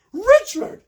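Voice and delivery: very high, very loud shriek. A narrator's reading. Prince John shrieking at his unmasked brother, very high and loud. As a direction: scream the name in shock. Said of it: "shrieks the prince".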